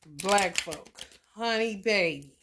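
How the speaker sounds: normal talking voice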